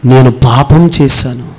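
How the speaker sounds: slow, low and contrite; humble confession. Acted. David admitting his sin to the prophet, slow and low. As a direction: confess it slowly, head bowed. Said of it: "అతను మొట్టమొదటిగా చెబుతున్న మాట".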